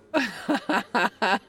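loud falling laugh of triumph; gloating laugh. Acted. Gloating at her friend's shocked face with a loud laugh.

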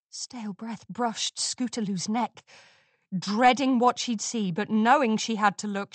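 usual speaking voice

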